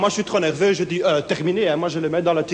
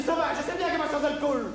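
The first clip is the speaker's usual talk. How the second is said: much higher and softer, defensive; put-on Gilbert voice; 10 semitones higher, 6 dB quieter than usual. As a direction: squeak it back sheepishly. Gilbert's reply, acted.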